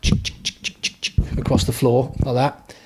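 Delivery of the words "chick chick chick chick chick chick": shuffling feet noise. Retold or imitated. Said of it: "Doing Suriashi".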